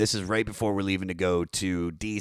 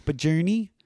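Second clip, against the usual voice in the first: higher Maryland twang, rising question; Baltimore accent; 7 semitones higher, 4 dB louder than usual. Shown as a demonstration. Musician demonstrating his hometown sound, Baltimore accent. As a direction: say it in broad Baltimore speech. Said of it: "roll my O's".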